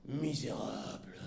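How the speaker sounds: low, quiet growl of fury; hoarse low roar. A narrator's reading. Enraged wife finally answering her husband, hoarse low roar. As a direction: growl it through choking rage. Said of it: "rugit-elle enfin".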